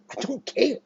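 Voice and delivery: high, airy I don't care; mock defiance. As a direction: toss it off like a taunt. Mock defiant aside to America.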